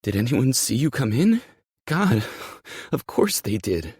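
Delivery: high, loud, worried voice; palpably anxious. Acted. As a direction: fret out loud in a panic. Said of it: "Her anxiety is palpable".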